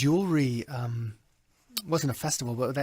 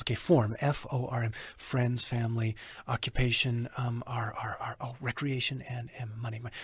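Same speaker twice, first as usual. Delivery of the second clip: soft rushed self-talk; mumbling the list under his breath; muttering to himself; 5 semitones lower, 8 dB quieter than usual. Retold or imitated. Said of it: "I might have done something like this".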